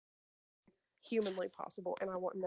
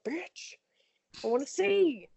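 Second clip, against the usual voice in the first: retold high-pitched insistence; indignant demand; 7 semitones higher, 4 dB louder, slower than usual. Retelling her demand to watch the surgery, indignant. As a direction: jump up high, then push it louder.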